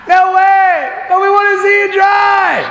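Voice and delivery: high, slow jeering shout from the back; twisted heckler. Retold or imitated. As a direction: yell it like the sicko in the crowd who wants a show. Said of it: "twisted individual in the back".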